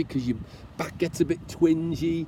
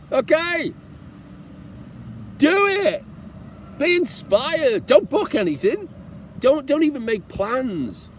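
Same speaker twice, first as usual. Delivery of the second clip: rousing call; 11 semitones higher than usual. Real, not acted. Traveller urging his viewers to just go, rousing. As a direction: fire them up to go.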